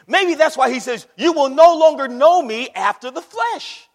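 high, loud sermon delivery; full-force preaching. Real, not acted. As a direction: drive the point home like a preacher.

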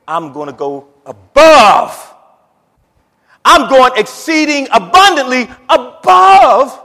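high, loud cry with stretched key words; full-force preaching. Real, not acted. Preacher proclaiming God's measure to congregation, soaring loud and high. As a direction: belt it out, soaring to a shout.

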